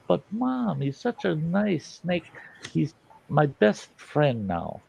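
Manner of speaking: put-on high, drawn-out kid's plea; heartbroken pleading. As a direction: plead with mom like a little snake.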